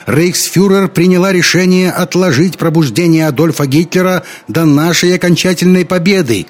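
loud, high announcement; shouting over crowd. A narrator's reading. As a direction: shout an official decision over a noisy crowd. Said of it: "кричала она, пытаясь перекрыть гул толпы".

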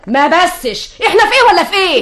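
high-pitched, rising irritation; exasperated scolding. Acted. Mother silencing daughter's objection, exasperated. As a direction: cut off the objection crossly.

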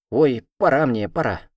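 loud, quick "time to go"; hasty exclamation. A narrator's reading. Cat exclaims before running off. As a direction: blurt it and bolt. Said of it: "воскликнул кот".

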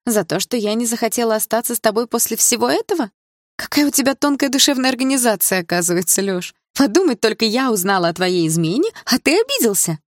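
sarcastic indignation. Acted. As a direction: ridicule his hurt feelings.

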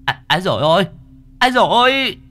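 high, startled woman's shout; woman's yelp. A narrator's reading. Woman knocked over by a stranger, yelping shout. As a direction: cry out sharply on impact in a high female voice. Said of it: "có tiếng người phụ nữ quát".

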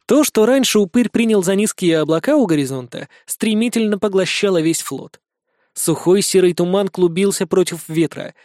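usual speaking voice

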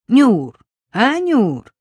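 громкий оклик через весь магазин, выше и громче; позвать продавщицу, растягивая имя; зовёт Нюрку. Acted. (громко окликает продавщицу)

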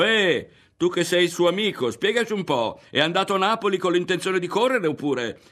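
high, loud clamouring questions; shouted demand for an explanation; shouting. A narrator's reading. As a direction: call out like one of an excited crowd. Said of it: "gli si avvicinavano gridando".

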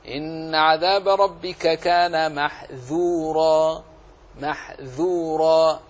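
slow recitation, thin unemphatic dh in "محذورا"; open, light dhal. Shown as a demonstration. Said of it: "خلص الانفتاح في الذال". (ترتيل مع ترقيق الذال في "محذورا")

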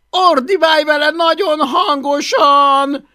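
high, slow bellowed order; very loud yelling. A narrator's reading. Man tells boy how to command dragon, bellowing. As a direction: bellow every word as an order. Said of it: "ordibálta Plépofa nagyon hangosan".

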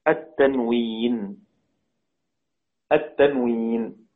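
التنوين drawn out slow and low; slow emphatic key word; stressed slow term. Shown as a demonstration. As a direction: spell out التنوين slowly for the class. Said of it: "من موانع الإدغام".

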